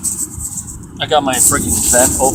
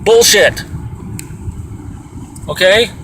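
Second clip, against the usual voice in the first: angry outburst; 8 semitones higher, slower than usual. Real, not acted. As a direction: spit it out in disgust. Angry rant about busybodies.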